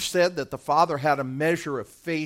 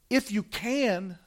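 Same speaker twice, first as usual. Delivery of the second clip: softer and slow, calmly reassuring; gentle encouragement; 6 semitones higher, 3 dB quieter, slower than usual. Retold or imitated. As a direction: hand it back gently, like an invitation. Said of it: "This is the encouragement of faith".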